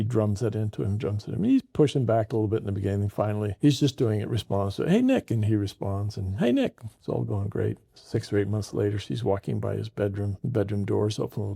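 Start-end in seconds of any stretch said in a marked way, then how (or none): 4.9-5.3 high, bright call of the name. Retold or imitated. Calling the boy by new name. 6.4-6.8 high, bright call of the name. Retold or imitated. Calling the boy by new name.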